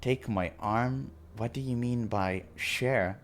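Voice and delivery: ordinary talk, nothing marked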